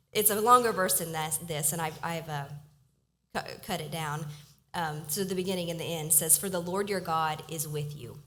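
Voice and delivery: ordinary delivery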